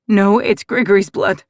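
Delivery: ordinary delivery, nothing marked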